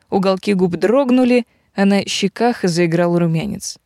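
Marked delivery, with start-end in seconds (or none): none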